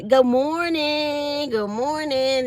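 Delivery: high, slow chirpy good morning; cheerful singsong office greeting; sing-song greeting. Retold or imitated. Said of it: "I just go around saying".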